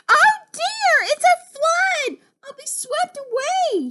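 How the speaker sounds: very high, loud panic, easing in volume; alarmed cry. Acted. Frog seeing the flood rise, crying out in alarm. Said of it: "he cried".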